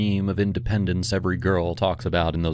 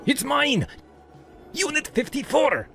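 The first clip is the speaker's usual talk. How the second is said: very high, outraged shout; angry ranting. A narrator's reading. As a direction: shout a furious claim to the police. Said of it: "while he ranted".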